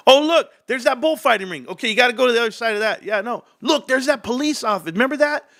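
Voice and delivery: put-on high, thrilled kid spotting places; excited kid voice. Kids recognizing landmarks for cops, high excited voice. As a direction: point out landmarks with kid glee.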